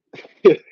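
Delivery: sudden loud burst of laughter; short amused laugh. Real, not acted. Streamer reacting to an angry chat commenter, short loud laugh. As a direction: let out one quick, loud chuckle. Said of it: "It's just making me laugh".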